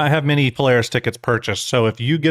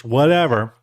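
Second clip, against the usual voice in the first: normal talking voice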